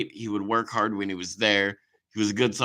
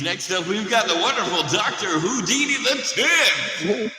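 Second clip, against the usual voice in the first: club DJ voice; 4 semitones higher than usual. Pretend. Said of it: "I get that D J voice".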